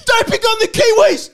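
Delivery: high, loud impassioned protest; outraged shouting. Real, not acted. Ranting podcaster tells co-hosts to leave the Kiwis alone, outraged shouting. Heated outburst defending the Kiwis.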